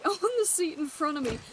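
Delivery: usual speaking voice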